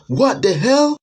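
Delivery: high loud scream into what the hell; terrified scream. Acted. Man seeing his friend's body, screaming in terror. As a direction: shriek like a frightened baby. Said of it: "let out a scream like a little baby".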